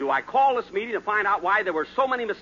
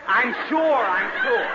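exasperated; 6 semitones higher, 6 dB louder, slower than usual. Acted. Exasperated answer to the recording.

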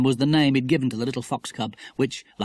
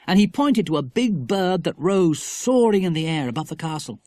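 ordinary delivery

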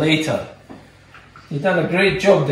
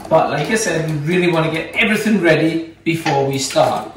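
normal talking voice

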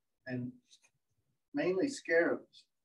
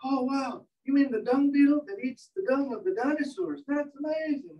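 mock excited; 6 semitones higher than usual. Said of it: "the guy was all excited".

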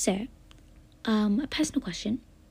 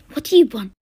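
irritated shout; 3 semitones higher, 13 dB louder than usual. Acted. Character snaps at being called.

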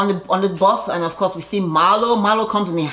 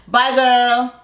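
sassy bye; 6 semitones higher, 4 dB louder than usual. Real, not acted. Recapping a housewife exiting dinner, sassy goodbye. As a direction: wave her off with attitude.

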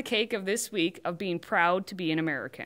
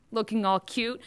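usual speaking voice

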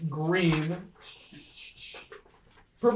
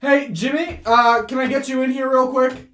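raised, louder urgent call; 8 semitones higher, 7 dB louder than usual. Acted. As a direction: call an assistant in from the lab. Gerald calls Jimmy into lab.

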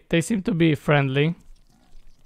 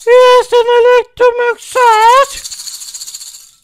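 very high, loud, slow squeal; drawn-out squealed celebration; squealing joy; 8 dB louder, slower than usual. Pretend. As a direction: screech it out high, stretching the words. Overjoyed at landing, silly eagle outburst.

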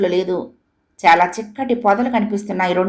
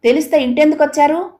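high-pitched, indignant challenge; shouted angrily; 7 semitones higher, 3 dB louder than usual. A narrator's reading. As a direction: cry out the question in protest. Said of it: "గట్టిగా అరిచింది".

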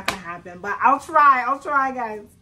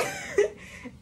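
high squealing laugh. Real, not acted. Vlogger cracking up at the moon rock biscuit, high squeal.

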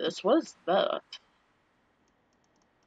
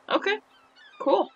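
high squeak; 12 semitones higher than usual. Real, not acted. Squeaky high excited reaction.

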